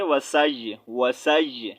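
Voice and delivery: وسائی ending on a light held y sound; light final ya. Shown as a demonstration. Teacher showing how to stop on ya, light-ending demonstration. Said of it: "last میں یا کی آواز ہلکی سی".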